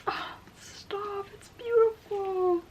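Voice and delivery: high, slow, teary awe; choked up with happy tears; tearful joy. Real, not acted. Overwhelmed opening a friend's book.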